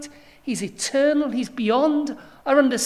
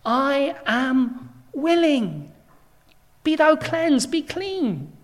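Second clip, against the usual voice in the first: slow and a bit louder, solemn; a hint of Jesus answering the leper, slow solemn; gracious Jesus; 5 dB louder, slower than usual. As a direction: grant it with slow solemn authority. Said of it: "Jesus said".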